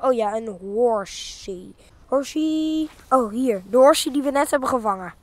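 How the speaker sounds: usual speaking voice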